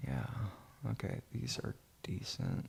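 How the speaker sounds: ordinary talk, nothing marked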